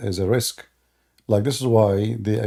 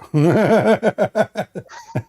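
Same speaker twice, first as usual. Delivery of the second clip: high, loud incredulous laugh; a big disbelieving laugh; loud burst of laughter; 11 semitones higher, 5 dB louder than usual. Real, not acted. Laughs at opponent's twenty-second demand.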